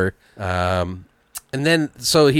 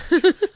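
high shrieking laugh; losing it at the kale log joke. Real, not acted.

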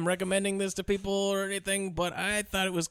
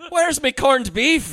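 higher, slow, louder mock brogue; cartoon Irish accent; 8 semitones higher, 7 dB louder, much slower than usual. Joking about Irish clichés, slow loud brogue. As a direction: demand it stage-Irish. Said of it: "god-awful accent".

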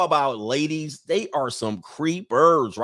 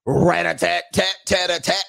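higher, punchy rat-a-tat gun noises; mock gunfire burst; gunfire imitation; 4 semitones higher, 4 dB louder than usual. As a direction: rattle off machine-gun sounds with a rolled start.

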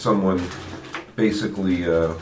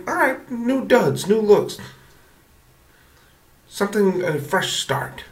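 excited team voice; 13 semitones higher, slower than usual. Retold or imitated. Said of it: "there's probably also something like".

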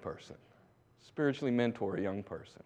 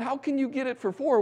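astonished; 12 semitones higher than usual. Retold or imitated. Missionaries' astonished question.

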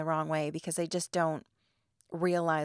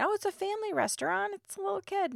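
reassuring staff; 12 semitones higher, faster than usual. Retold or imitated. Staff reassuring her, quoted.

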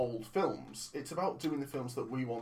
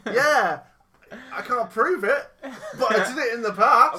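loud, high laughing taunt; laughing mockery; 9 semitones higher, 13 dB louder than usual. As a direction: laugh it out mockingly. Mocking partner's vague excuse.

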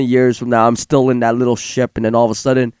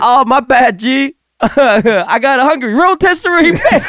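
laughing mock apology; 9 semitones higher than usual. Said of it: "It's like".

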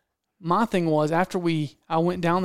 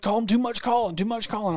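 higher-pitched imitation of his objection; mock worried hunter; 5 semitones higher than usual. Hunter objecting to the guide's orders, high mock fretting. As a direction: put on his fretful protest, pitched up. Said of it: "He's like".

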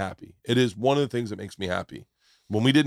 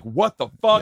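shocked outburst; 9 semitones higher, 5 dB louder than usual. Retold or imitated. Imagined shocked reaction after landing.

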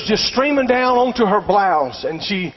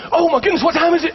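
sudden alarm; 8 semitones higher, faster than usual. Retold or imitated. Girl realizes she is late.

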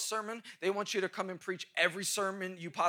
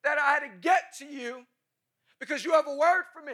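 high, loud, breathless excitement; imitating an excited young woman; excited young girl; 7 semitones higher, 6 dB louder than usual. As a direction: gush it out like an eager fan. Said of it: "She goes".

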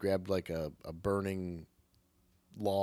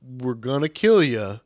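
loud, emphatic alien ultimatum; hammed-up loud declaration; loud mock-earnest plea; 4 semitones higher, 9 dB louder than usual. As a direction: announce it firmly, as a comically reasonable alien. Voices the aliens reasoning with victims.

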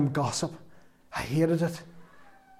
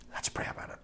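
whispered; 16 dB quieter, faster than usual. Real, not acted. Soft emotional aside.

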